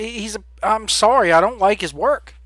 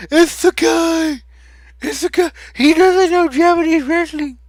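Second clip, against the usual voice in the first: mocking fans who shout corrections into their headsets; 9 semitones higher, 7 dB louder than usual. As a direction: shout it like an angry know-it-all fan. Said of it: "Japanese marks can stop shouting".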